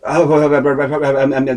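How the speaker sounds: imitating a flustered stammer; panicked stammering. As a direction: trip over a string of uhs and ums. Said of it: "you could tell there was fear in his voice".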